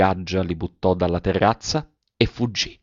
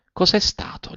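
sussurro timido e acuto; timidamente; 11 semitones higher, 4 dB quieter than usual. A narrator's reading. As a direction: chiedi piano, quasi senza voce. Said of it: "gli domandò timidamente". (un sussurro timido)

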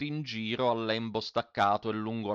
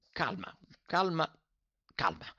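urging calm; 6 semitones higher than usual. A narrator's reading. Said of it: "si mise a raccomandare".

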